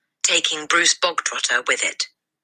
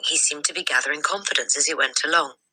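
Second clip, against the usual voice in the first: plain reading voice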